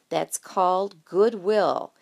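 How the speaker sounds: slow, deliberate naming of the term; slowly. Acted. Jessie recalling a business term, slowly. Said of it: "she said slowly".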